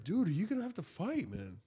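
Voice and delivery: high, hushed question; mock-worried, high and quiet; acted concern. Teasing worried question to rival.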